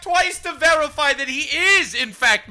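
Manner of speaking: loud laughing disbelief. Real, not acted. Laughing disbelief after quote.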